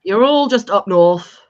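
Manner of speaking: slow and loud "up north"; mock northern. Teasing northerners, slow loud mock northern. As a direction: boom it like a proud Yorkshire lad.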